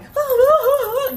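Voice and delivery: high, loud panicky ah ah ah; the scream she did not let out; mock panicked cries. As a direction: squeal in fake fright. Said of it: "instead of going".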